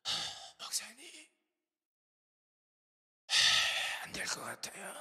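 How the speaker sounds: loud breathy gasped whisper of a sick patient; acting out someone too breathless to speak; gasping for breath. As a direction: force the words out on heavy, voiceless breaths. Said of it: "이렇게 말씀하셨어요".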